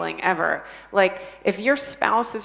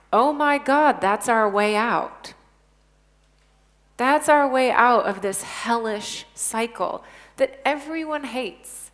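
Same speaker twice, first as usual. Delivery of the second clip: excited realization; 4 semitones higher, 6 dB louder than usual. Retold or imitated. Retold realization of teen.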